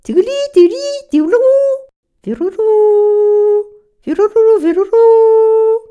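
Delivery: whistle it like a songbird; birdsong imitation. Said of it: "pour imiter et appeler toutes sortes d'oiseaux". (chant d'oiseau aigu et fort)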